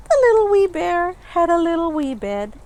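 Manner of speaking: little wee bear said high, quick and bright-sized; small high voice. A narrator's reading. Reading to children, the little wee bear line in a small quick voice. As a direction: make it sound tiny for the children.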